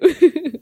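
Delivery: high loud laugh; burst of laughter. Real, not acted. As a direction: crack up at own joke. Laughs after ad parody.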